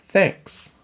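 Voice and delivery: put-on high girlish thanks; grateful little sister. A narrator's reading. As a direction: pipe up with thanks. Annie thanks her brother.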